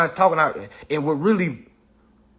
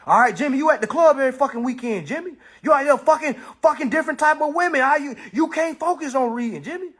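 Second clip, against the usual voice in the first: scolding coach; 8 semitones higher than usual. Retold or imitated. Coach yelling at Jimmy about partying and not reading.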